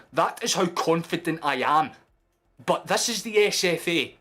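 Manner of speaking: worked-up fan arguing; heated insistence. Real, not acted. Pleading case for handball.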